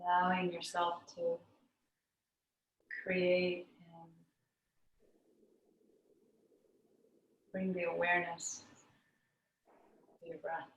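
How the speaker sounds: calm meditation teacher with long pauses; guided meditation. Real, not acted. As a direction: guide them inward, unhurried, leaving long silences between phrases.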